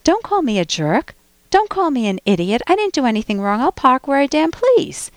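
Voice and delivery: defensive mock protest, swooping pitch; rebellious. Host voicing the attacked person's reaction, rebellious and defensive. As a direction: talk back with indignant defiance. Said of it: "you get into this rebellious".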